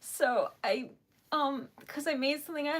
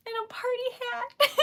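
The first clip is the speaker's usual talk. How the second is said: usual speaking voice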